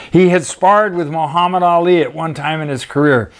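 usual speaking voice

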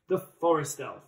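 usual speaking voice

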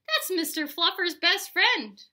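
loud, high-pitched protest; indignant outcry. A narrator's reading. Spencer defends his bunny's friend, crying out indignantly. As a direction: object as if it's unthinkable.

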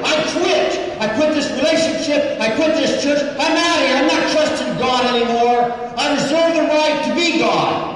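high, loud ranting quit speech; angry mock outburst. Mayor storming out, shouting she quits. Mayor voiced quitting in anger.

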